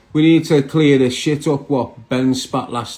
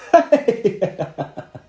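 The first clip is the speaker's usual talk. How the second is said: laugh; 7 semitones higher than usual. Real, not acted. Laughs at caller.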